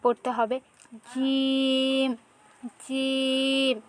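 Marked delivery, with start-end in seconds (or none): 1.1-3.8 জিম drawn out very slowly, middle of tongue on the palate; slow letter demo for students; Arabic jim. Shown as a demonstration. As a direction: stretch জিম long and slow. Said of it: "জিহ্বার মধ্যখান উপরের তালুর সাথে লাগিয়ে পড়তে হবে".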